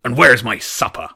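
loud, menacing monster; booming voice. A narrator's reading. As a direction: boom out the threat. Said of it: "the voice boomed".

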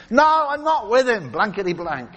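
high, loud protest at first; mock swearing denial. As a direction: deny it hotly, acting out Peter's outburst. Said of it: "cursing and swearing and saying".

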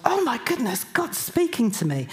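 high, amazed exclamation; startled amazement. Retold or imitated. Retelling her first thought on waking, startled and amazed. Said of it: "my first thought was".

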